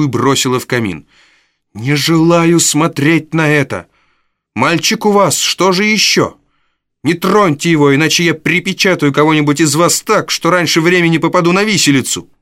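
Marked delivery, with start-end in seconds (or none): 1.7-12.3 furious Nancy. Acted. Nancy defends the boy.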